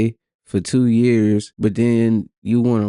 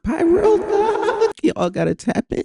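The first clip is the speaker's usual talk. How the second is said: bursts into a high, squealing laugh on the name; cracking up at his own plug. Real, not acted. Streamer laughing as he shouts out his weed strain.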